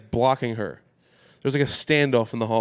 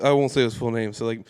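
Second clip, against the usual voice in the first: usual speaking voice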